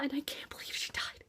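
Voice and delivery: soft breathy whisper of disbelief; whispered grief. Real, not acted. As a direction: whisper it like it hurts. Grieving a character.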